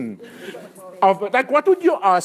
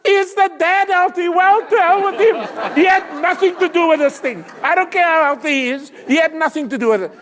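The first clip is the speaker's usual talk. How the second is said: mock rant; 8 semitones higher, 5 dB louder than usual. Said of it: "mock rant at audience".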